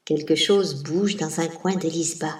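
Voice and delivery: plain reading voice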